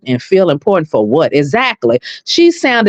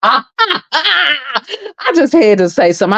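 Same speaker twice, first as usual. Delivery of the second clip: laughing; 6 semitones higher, much slower than usual. Real, not acted. Laughing while retelling.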